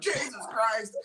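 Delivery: high-pitched jumpy yelp of words; mock fright. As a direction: pretend to be startled out of your wits. Acts jumpy scare in store.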